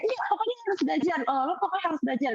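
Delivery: fast, high pestering friend; retold urging, high and hurried; imitated insistence. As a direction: act out a friend pushing you, quickly. Retold friend insisting she learn.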